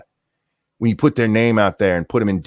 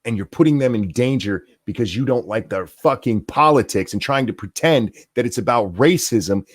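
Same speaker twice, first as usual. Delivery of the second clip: ordinary delivery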